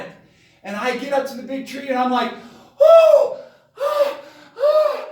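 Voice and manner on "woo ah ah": high, loud, hammed-up panic yelps; breathless high-pitched whooping; panicked hollering. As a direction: yelp like a man who just fled a monster. Panting, panicked at the tree.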